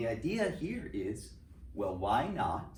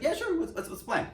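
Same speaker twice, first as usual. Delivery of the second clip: high mock Truman. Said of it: "And Truman's like".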